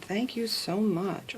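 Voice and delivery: normal talking voice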